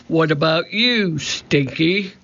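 mildly roaring pirate captain; roared. A narrator's reading. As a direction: call on a crewman with a restrained roar. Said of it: "Cap'n Bones roared".

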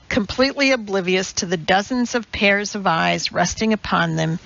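plain reading voice

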